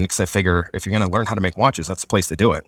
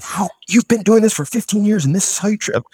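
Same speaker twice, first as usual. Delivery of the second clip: imitated angry yelling, pitched much higher; mock scream; 11 semitones higher than usual. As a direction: act out an instructor screaming at a student. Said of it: "he's screaming at him like".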